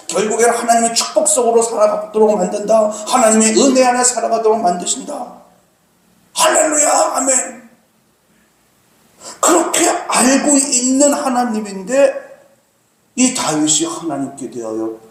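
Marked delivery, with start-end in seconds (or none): none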